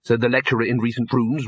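ordinary delivery

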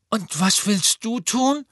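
put-on high slow boy's voice, sure of himself; Neville, relieved. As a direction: reassure Harry as Neville. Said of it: "sagte Neville".

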